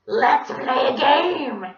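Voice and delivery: loud, slow squawking villain; high, drawn-out menacing bray; creepy French donkey voice. Acted. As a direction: taunt like a hoarse French donkey. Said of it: "his normal creepy French donkey voice".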